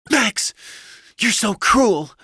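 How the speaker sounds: very high, loud wifely rebuke; loud, high-pitched upset wife; hurt wife. A narrator's reading. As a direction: reproach him in a high, wounded voice. Wife rebukes cruel husband.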